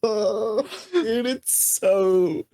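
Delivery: high, slow, laughing through the words; too thrilled to get the words out; laughing with excitement. Real, not acted. Cohost overjoyed by the scene, laughing high. Hyped over the hero moment.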